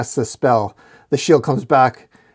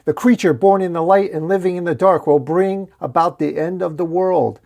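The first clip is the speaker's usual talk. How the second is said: raised, grand pronouncement of doom; prophecy proclamation; 6 semitones higher than usual. A narrator's reading. Red orc reciting the prophecy, proclaiming. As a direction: declaim it high and steady like an omen.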